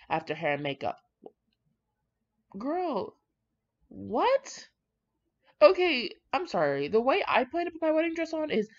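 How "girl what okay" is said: shocked at what she just read; disbelief. Real, not acted. Reader stunned by the bride's dressing plan, high disbelief.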